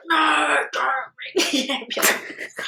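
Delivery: high, slow, pleading wail; wailed mock refusal. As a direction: moan it as if mid-contraction, laugh breaking in. Acting labouring refusal of ambulance.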